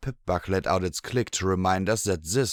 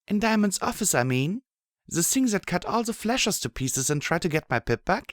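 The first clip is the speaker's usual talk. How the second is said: put-on higher young mare voice; Blackjack voice; 11 semitones higher than usual. A narrator's reading. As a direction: voice the heroine, higher. Narrator voicing Blackjack.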